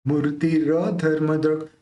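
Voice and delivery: मूर्ति र धर्मद्रक में र साफ़ और पूरा बोलें; र को अलग, पूरा उच्चारित करें. Shown as a demonstration. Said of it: "र पूरा लेना है". (र पूरा निकालते हुए)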